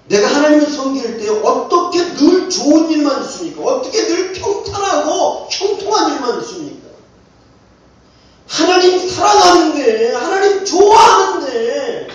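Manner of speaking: high, pleading pulpit cry; impassioned preaching. Real, not acted.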